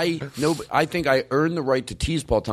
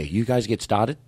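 attempted Boston accent; practising a Boston accent in speaking voice; slower than usual. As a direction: copy the coach's Boston accent as a question. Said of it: "let's practice our Boston accent".

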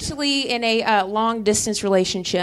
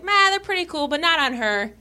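put-on dismissive boyfriend; 7 semitones higher than usual. Retells boyfriend's dismissive verdict.

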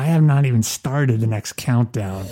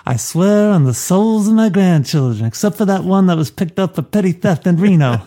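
put-on godfather swearing solemnly, pitched up; Don Corleone; 5 semitones higher than usual. Host improvises what the Don should have said, Don Corleone impression. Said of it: "Don Corleone really should have said".

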